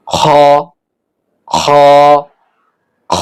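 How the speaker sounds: "খ" scraped from the far end of the throat; separate, held "খ" with back-of-throat friction; from the throat's end. Shown as a demonstration. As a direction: make each "খ" a throaty Arabic kh. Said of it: "গলার শেষ থেকে".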